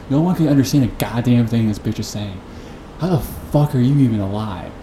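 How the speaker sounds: ordinary delivery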